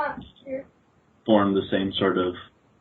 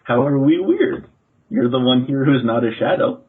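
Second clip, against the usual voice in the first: put-on higher-pitched boyish retort; indignant shadow boy; 5 semitones higher, 4 dB louder than usual. Shadow boy answers being called weird, indignant. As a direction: push back like a puzzled child, pitched up.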